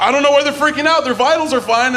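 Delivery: puzzled scientists. Retold or imitated. Scientists watching monkeys, fast baffled chatter. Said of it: "the people were like".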